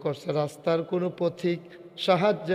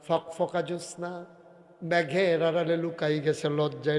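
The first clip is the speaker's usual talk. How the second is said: ordinary talk, nothing marked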